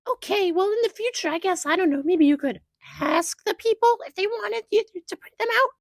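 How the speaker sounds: very high, mock huffy customer voice; condescending customer. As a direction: lecture the worker in a squeaky voice. Customer's parting reply voiced.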